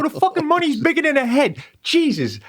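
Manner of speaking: high-pitched comic ranting; mock exasperation. Parent retelling a gripe about Barbie money, mock exasperation. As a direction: rant in hammed-up disbelief.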